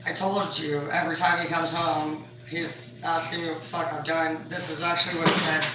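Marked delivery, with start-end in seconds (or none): none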